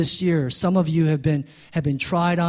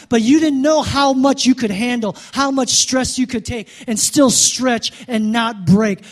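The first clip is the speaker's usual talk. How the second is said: preaching at full force, higher and louder; fiery preaching; 6 semitones higher, 6 dB louder than usual. Real, not acted. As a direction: drive it home like a revival preacher. Preaching builds up.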